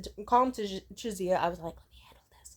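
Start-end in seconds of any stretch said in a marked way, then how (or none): 1.7-2.6 whisper. Retold or imitated. Calming friend, whispered aside.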